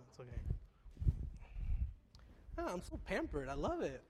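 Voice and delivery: normal talking voice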